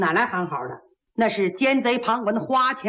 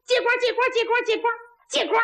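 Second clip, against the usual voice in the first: 高声吆喝; 8 semitones higher, faster than usual. Acted. 擂台上喊招式.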